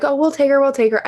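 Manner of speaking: hammed-up eager "we'll take her"; eager applicants. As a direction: gush like someone desperate to adopt. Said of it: "so many people like".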